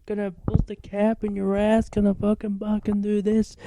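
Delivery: hammed-up wannabe gangsta picking a fight; wannabe rapper threat. Kid threatening narrator before a fight, mock rapper voice. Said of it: "He tried to fight me".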